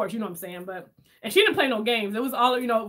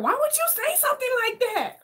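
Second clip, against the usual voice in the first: put-on high indignant boy voice; a nephew protesting his aunt, high and offended; 13 semitones higher than usual. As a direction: pitch it way up, wounded. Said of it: "he was like".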